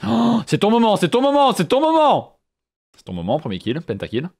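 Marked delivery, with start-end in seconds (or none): 0.0-2.4 high, loud, thrilled cheering; excited shouting. Real, not acted. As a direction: scream encouragement at the big moment. Cheering teammate's big play.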